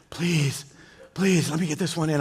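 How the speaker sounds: soft, pleading whisper-prayer to God; hushed pleading prayer. Retold or imitated. Fisherman alone by the river, softly begging God to land it. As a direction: beg quietly, holding your breath for the catch. Praying alone while landing fish.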